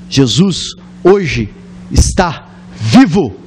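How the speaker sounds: slow, weighty preaching; slow each word down for the climax; slow emphatic declaration that Jesus lives; solemn proclamation. Real, not acted.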